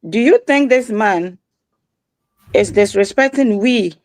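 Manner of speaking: usual speaking voice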